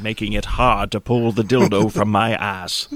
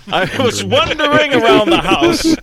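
cracking up; 4 dB louder than usual. Real, not acted. Repeats line while cracking up.

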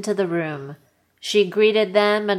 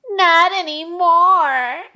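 much higher whine, stress on wear; whiny high Thea voice; 9 semitones higher, 4 dB louder, slower than usual. Acted. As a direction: whine it like a sulky child. Thea voice, relieved.

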